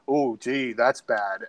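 imitating a low, soft, mock-concerned "oh gee"; a mild, quiet sarcastic shrug of a line; lightly imitated sarcasm, low and soft; sarcastic Trump. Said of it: "you could hear the sarcasm in his voice".

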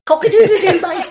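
very high Cockney crow; squawking comic Cockney bird; Cockney rooster. Acted. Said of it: "gotta work that Cockney accent".

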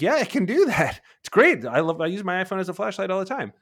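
high, loud hammed-up enthusiasm; mock gushing. Imitating a user gushing to a friend, high and loud. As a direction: gush over a trivial feature like a fan.

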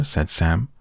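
plain reading voice

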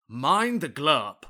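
slow, grand "Mind the glurp"; glurp for gap. Acted. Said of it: "you don't seem able to pronounce the word gap".

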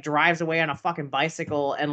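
ordinary talk, nothing marked

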